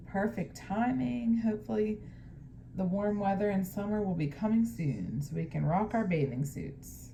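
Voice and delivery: plain reading voice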